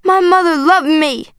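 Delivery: crying out. A narrator's reading. Girl insisting to father on mother's love, crying out. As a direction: cry it out in protest. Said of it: "I cried".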